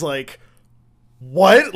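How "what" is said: high, loud startled "what"; shocked reaction. Retold or imitated. Retelling coworker's reaction to friend, shocked. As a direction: blurt it out in mock shock.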